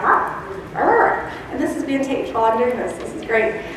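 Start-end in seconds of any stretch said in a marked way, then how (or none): 0.0-1.3 dog barking. Retold or imitated. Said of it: "and he's like".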